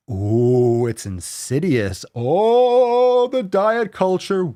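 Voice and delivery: high, hammed-up ghostly wail dropping to a whisper; spooky ghost voice. Host mocking a diet post like a ghost story, hammed-up spooky voice.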